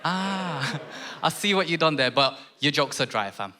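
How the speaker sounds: ordinary talk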